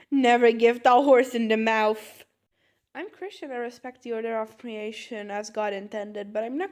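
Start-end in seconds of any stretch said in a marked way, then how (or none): 0.1-2.2 much louder, emphatic proclamation; loud, firm declaration; loud correction. Acted. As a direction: belt out the botched proverb with confidence. Mangled proverb, triumphant correction.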